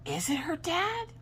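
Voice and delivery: very high, soft, slow question; joking suspicion, squeaked quietly; mock doubt. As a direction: wonder it in a tiny high voice. Acted doubting aside about paternity.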